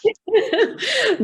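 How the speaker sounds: a high, real laugh at herself. Real, not acted.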